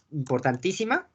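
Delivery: ordinary talk, nothing marked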